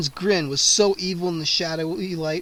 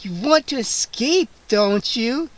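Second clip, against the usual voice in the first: teasing; 5 semitones higher, slower than usual. A narrator's reading. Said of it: "she teased".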